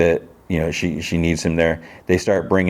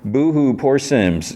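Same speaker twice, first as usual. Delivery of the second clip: higher, sarcastic fake pity; mock boo-hoo; 7 semitones higher, much slower than usual. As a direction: sneer at his self-pity with a teasing boo-hoo. Mocking Sims's self-pity.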